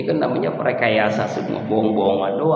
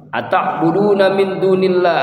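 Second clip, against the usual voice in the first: high, loud chanted Arabic verse; Quranic recitation; 8 semitones higher, 5 dB louder than usual. Acted. Quran recitation within sermon. (melantunkan ayat dengan lantang)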